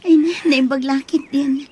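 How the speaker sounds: whispered thanks under her breath; relieved whisper. Acted. Mother relieved by the good news, whispered.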